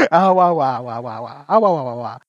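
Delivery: muted trombone grown-up voice; muffled wah wah talk. Pretend. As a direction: babble it like a muted horn. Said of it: "You see him saying, "Ah, wah, wah".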